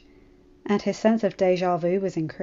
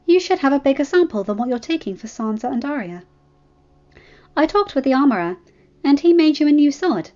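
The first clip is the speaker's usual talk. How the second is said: put-on higher, proud young woman explaining her plan; lively and pleased with herself; Daenerys voice; 4 semitones higher, 4 dB louder than usual. Dany explains her gift.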